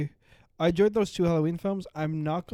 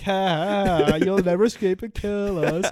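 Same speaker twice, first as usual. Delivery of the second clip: loud, cackling taunt at the slashers; mocking villain taunt; 5 semitones higher, 12 dB louder, slower than usual. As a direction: laugh at them loudly from a distance. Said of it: "Like, like".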